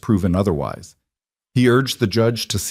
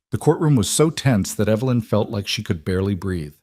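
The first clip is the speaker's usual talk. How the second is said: usual speaking voice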